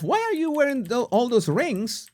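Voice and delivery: high-pitched mock outrage; mock scolding. Host to Megan about her rings in salad, mock scolding.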